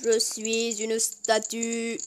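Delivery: slow mock statue drone. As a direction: say it stiffly like a statue. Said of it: "frozen statue pose".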